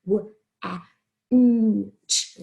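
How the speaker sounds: w, e, n, t as four separate sounds; sounded out. Shown as a demonstration. Teacher helping class not write wet, sounded out. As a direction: isolate each sound of WENT, leaning on the n. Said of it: "We've got to think".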